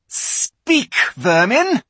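high, loud contemptuous order; scornful witch command; witch queen. A narrator's reading. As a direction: spit it at the animals. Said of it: "she said again".

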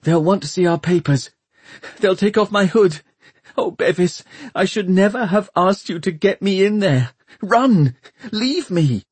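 high, frightened plea building in urgency; desperate high-pitched whimper; whimpering panic. A narrator's reading. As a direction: panic and beg your companion to go. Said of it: "she whimpered".

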